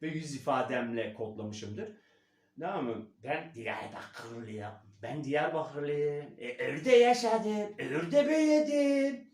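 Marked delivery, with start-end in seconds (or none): 3.2-9.3 Eastern Turkish accent. Shown as a demonstration. Coach switching into Eastern accent on his trigger word. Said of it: "Doğulu şivesine geçiyorum".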